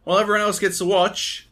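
high, loud, put-out whine of protest; indignant complaint. Real, not acted. Co-host griping at being left out of the footage, indignant. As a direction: protest loudly, pitched right up. Mock tantrum over not seeing footage.

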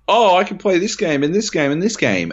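high-pitched imitation of eager gamers; mock excited fans. Mimicking buyers keen on the games, mock excited. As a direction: gush it high, listing on and on. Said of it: "They're all like".

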